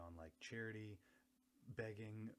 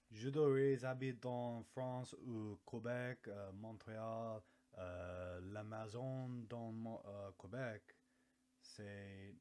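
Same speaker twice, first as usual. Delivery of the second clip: put-on French accent; 6 dB louder, slower than usual. Mock French speaker bit.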